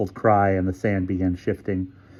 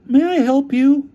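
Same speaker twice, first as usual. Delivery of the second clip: character voice; 6 dB louder, slower than usual. A narrator's reading. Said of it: "asked the desk sergeant".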